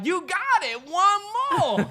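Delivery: high, loud encouraging cheer; hyped crowd shouting encouragement. Retold or imitated. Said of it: "they was like".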